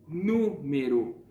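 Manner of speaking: Número slowed right down, with a strong, drawn-out NÚ; stress on the tonic syllable. Shown as a demonstration. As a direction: stretch and punch the first syllable of Número. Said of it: "eu fiz uma pronúncia mais forte".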